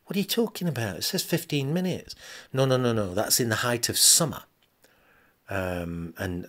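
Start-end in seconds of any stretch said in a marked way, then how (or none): none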